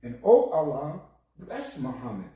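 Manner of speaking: ordinary talk, nothing marked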